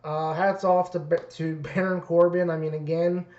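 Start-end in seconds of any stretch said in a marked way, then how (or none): none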